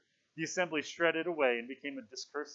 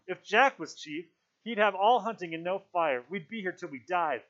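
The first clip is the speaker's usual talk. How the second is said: raised, loud worried protest; rising squeak; 5 semitones higher, 6 dB louder than usual. A narrator's reading. Ralph dreads Jack as chief, voice raised. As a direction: push the voice up in alarm. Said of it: "His voice ran up to a squeak".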